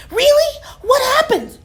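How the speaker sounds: high excited questions; eager. A narrator's reading. Mabel eager to hear the detective's case, high and excited. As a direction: lean in, thrilled.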